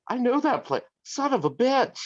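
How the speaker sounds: high, disbelieving son of a bitch; stunned recognition. Retold or imitated. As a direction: realize with a jolt it's your own town. Recognizing his own town.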